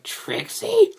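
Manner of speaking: very high, loud squeal rising at the end; hammed-up shock; mock startled question. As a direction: squeak the name in exaggerated disbelief. Retold character's startled question.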